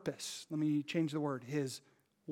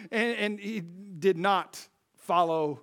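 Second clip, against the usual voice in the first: ordinary talk, nothing marked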